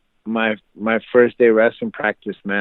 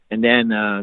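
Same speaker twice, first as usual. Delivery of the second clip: usual speaking voice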